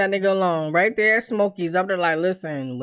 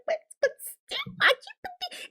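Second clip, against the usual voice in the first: mock high protest; 8 dB quieter than usual. Mocking Tiff's high protest.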